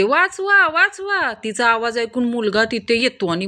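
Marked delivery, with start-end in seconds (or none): 0.0-1.3 shouting for help. Acted. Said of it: "ती ओरडू लागते".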